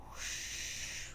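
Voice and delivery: breathy unvoiced whoosh sound. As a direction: blow out a soft rushing whoosh. Vision whiting out.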